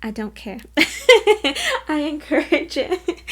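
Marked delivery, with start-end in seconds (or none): 0.6-1.8 high, loud burst of laughter; big laugh. Real, not acted. Laughing at counting on fingers. 1.9-3.2 high, amused, through leftover laughter; laughing while talking. Real, not acted. Laughing about counting on fingers.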